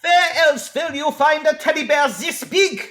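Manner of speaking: a hint of German, shouting at the crowd; German accent. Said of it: "heavily German accented carnival barker".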